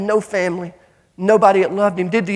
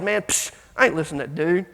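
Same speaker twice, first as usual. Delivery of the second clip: low, quieter scoff with a psh; dismissive attitude; 6 semitones lower, 5 dB quieter than usual. Retold or imitated. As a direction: brush it off under the voice, imitating a cocky teen. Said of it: "That was my attitude".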